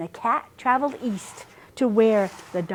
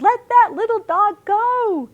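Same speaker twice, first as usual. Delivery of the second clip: high, loud desperate scream; 13 semitones higher, 7 dB louder than usual. A narrator's reading. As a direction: scream it to save her. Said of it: "Cow screamed".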